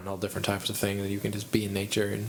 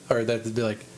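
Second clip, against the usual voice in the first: normal talking voice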